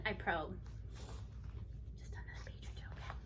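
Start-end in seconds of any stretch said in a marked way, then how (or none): 2.0-3.1 soft whispered aside. Real, not acted. Aside to child during reading.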